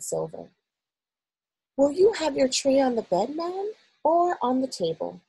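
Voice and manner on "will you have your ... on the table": put-on higher, polite elderly servant; old maid voice. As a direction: gently ask as an old maid. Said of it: "the voice of an oldish person".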